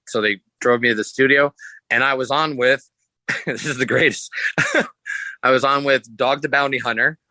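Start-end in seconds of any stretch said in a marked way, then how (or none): 3.2-5.4 chuckling through the words; laughing. Real, not acted. Guest laughing to the host about his odd booking, real laughter. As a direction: laugh while saying it, genuinely amused.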